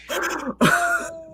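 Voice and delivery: high cackle; high laugh. Real, not acted. Laughing at being the asshole, high laugh. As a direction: let out a squealing laugh. Laughs after asking Reddit.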